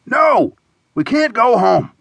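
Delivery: high-pitched, emphatic refusal; protesting friend. A narrator's reading. Friend refusing to abandon the trip, protesting.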